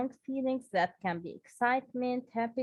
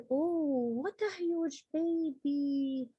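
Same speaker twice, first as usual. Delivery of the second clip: slow, drawn-out amazement, a bit higher; amazed exclamation; 4 semitones higher, slower than usual. Shown as a demonstration. Teacher showing surprise for an exclamation, slow amazed. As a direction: stretch it out in wonder. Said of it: "All of these are exclamation sentences".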